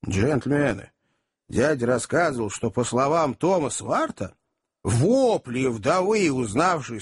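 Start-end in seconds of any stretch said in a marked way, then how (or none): none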